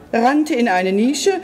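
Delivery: usual speaking voice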